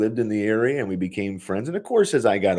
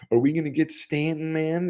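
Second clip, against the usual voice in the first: mock eager fan; 9 semitones higher than usual. Imagining badgering Bill about Stanton, pushy and higher. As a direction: play the nosy buddy fishing for trade news. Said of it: "And I don't go".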